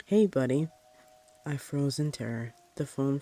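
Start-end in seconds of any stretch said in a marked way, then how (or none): 0.1-0.7 louder, eerie friendly call through the door; a monster faking a dad's cheer; lightly voiced creepy demon dad; menacing demon father.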